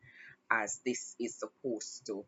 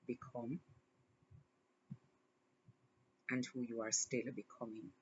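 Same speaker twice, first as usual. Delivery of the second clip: tender encouragement; 2 semitones lower than usual. Real, not acted. Tender words to her younger self.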